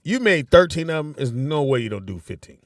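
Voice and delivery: ordinary delivery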